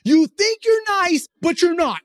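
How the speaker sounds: retold exasperated outburst, high and loud; frustrated outburst. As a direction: snap it high and loud, dragging the first jab. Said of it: "in my frustration".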